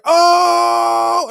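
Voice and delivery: loud, high, drawn-out holler; crowd's roaring "oh". Retold or imitated. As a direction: imitate the whole audience exploding at a burn. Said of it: "everybody's like".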